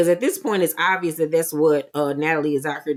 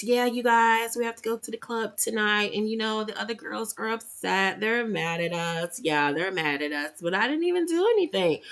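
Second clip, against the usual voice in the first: higher, softer mocking Valley Girl; 4 semitones higher, 5 dB quieter than usual. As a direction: imitate a breathy Valley Girl. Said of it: "her Valley Girl voice again".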